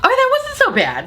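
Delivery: sudden high, relieved okay; high relieved sigh of a line; relieved squeak. Real, not acted. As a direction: jump way up on okay, then settle. Relieved after long review.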